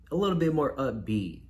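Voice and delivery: ordinary talk, nothing marked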